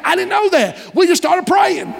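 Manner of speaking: high, emphatic preaching shout; fervent preaching cry. Real, not acted. Preacher telling church about revival, high and fervent. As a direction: lift the voice in holy fervour.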